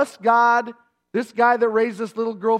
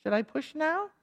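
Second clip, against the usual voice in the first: mock calm labor; 9 dB quieter than usual. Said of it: "weren't just going".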